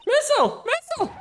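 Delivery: falsetto cry of alarm; very high, acted exclamation; high-pitched calling out. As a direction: call the dog in a squeaky panic. Character cries out for the dog.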